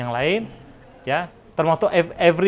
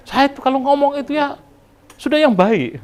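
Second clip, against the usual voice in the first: Batak intonation; 10 semitones higher, 3 dB louder than usual. Retold or imitated. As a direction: voice of a Batak speaker explaining himself, much higher. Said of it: "apa kata orang Batak".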